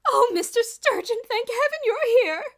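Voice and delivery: very high, loud, slow shrill relief; shrill it in agitation; shrill plea. A narrator's reading. Miss Scrimmage to Mister Sturgeon, shrill and agitated. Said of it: "shrilled Miss Scrimmage".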